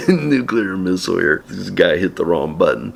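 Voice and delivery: ordinary talk, nothing marked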